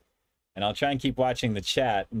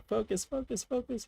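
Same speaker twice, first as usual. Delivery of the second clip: high coaxing; 9 semitones higher, 7 dB quieter than usual. Real, not acted. Coaxing the camera to autofocus.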